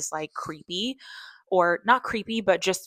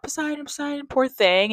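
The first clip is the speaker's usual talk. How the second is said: retold crying out; 10 semitones higher, 5 dB louder than usual. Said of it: "he's crying out".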